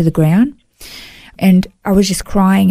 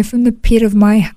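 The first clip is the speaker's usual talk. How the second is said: usual speaking voice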